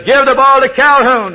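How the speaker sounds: highest and loudest, all-out holler; a coach screaming his last chance; the final full-force order; furious coach shout. Retold or imitated. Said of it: "the coach leaned over".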